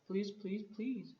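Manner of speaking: high, insistent run of pleases; insistent pleading. A narrator's reading. Boy begging his parents to name the rabbit, insistent pleading. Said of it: "and with each please, he squeezed".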